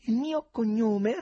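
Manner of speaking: high, slow tenor, quite untroubled; unperturbed stranger. A narrator's reading. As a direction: stall blithely in a high drawn-out voice. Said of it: "per nulla turbato da quella severità". (voce acuta e lenta, per nulla turbata)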